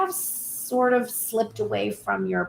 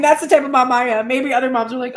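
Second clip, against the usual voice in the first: ordinary talk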